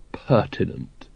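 low, soft, tentative word after a pause; hesitant. A narrator's reading. Vimes unsure what he is asking of the sergeant, hesitant.

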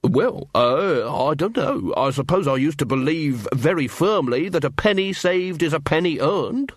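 unwary dwarf. Acted. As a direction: ramble uncertainly as a puzzled dwarf. Unwary dwarf answers Carrot.

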